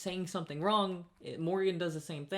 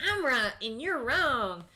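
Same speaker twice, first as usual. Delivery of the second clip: mock entitled customer; 7 semitones higher, 5 dB louder, slower than usual. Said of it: "She's like".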